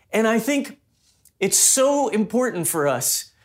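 ordinary delivery

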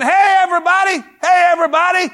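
high, loud call to a crowd. Acted. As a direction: shout it out like a herald. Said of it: "he's saying".

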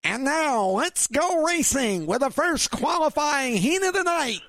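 high, slow race announcer call; Jack Burgess impression. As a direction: announce the qualifying heat to a crowd, stretching it out. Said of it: "Jack Burgess would say".

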